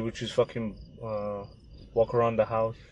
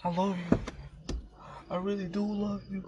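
high, mock-defensive denial that softens to a plea; hammed-up husband begging her to believe him; imitating the pleading husband; 7 semitones higher than usual. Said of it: "And he's like".

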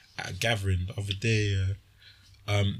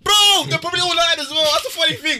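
excited; 8 dB louder than usual. Real, not acted. Incredulous outburst at friend's claim.

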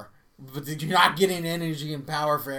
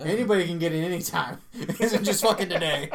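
laughing; 5 semitones higher, 7 dB louder than usual. Acted. Joking threat, laughing.

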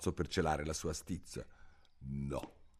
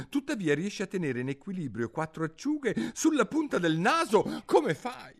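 higher, quicker mocking question; teasing son; 13 semitones higher, 4 dB louder than usual. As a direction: rush the jibe. Il figlio provoca. (figlio beffardo, rapido)

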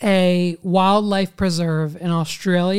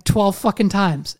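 ordinary talk, nothing marked

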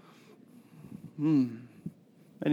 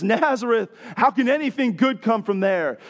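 scoffing Nathanael; 7 semitones higher, 4 dB louder than usual. Retold or imitated. Retells Nathanael's scoff, preaching.

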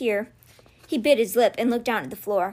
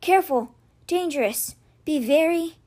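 higher, halting rasp, word by word; weak rasp; 4 semitones higher than usual. A narrator's reading. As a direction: rasp out warnings between pained breaths. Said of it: "she rasped".

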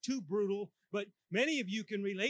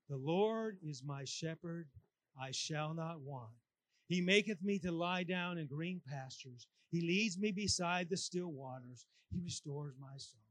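slow, softer recitation of the psalm; recited psalm; 5 semitones lower, 6 dB quieter than usual. Shown as a demonstration. Preacher leading the church in Psalm 23, slow soft recitation. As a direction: lead the congregation through it gently. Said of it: "quote this with me".

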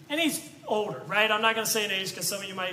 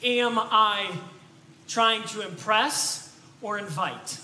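slow, weighty "trying to impress or invite"; slow question; slower than usual. Shown as a demonstration. Preacher posing the key question, slow and weighty. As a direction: set the question down slowly. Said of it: "ask ourselves this question".

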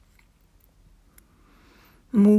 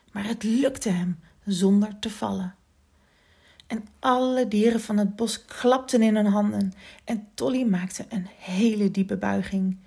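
plain reading voice

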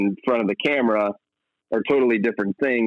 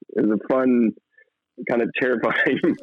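ordinary talk, nothing marked

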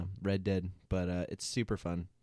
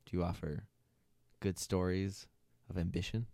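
ordinary talk, nothing marked

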